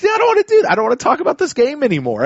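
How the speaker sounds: high-pitched, fed-up whine; mock whiny outburst. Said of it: "I was like".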